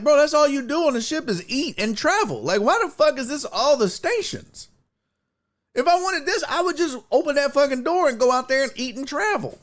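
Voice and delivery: high-pitched incredulous complaint; exasperated rant. Retold or imitated. Comedian griping about the ship's TV stations, exasperated.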